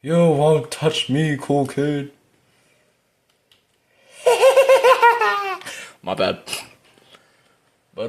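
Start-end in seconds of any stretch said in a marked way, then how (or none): 0.0-2.1 high, drawn-out taunt; playful taunting. Real, not acted. Player to the killer chasing them, taunting. 4.2-5.8 very high, loud rapid laugh; high cackle. Real, not acted. Player laughing after taunting the killer, high cackle.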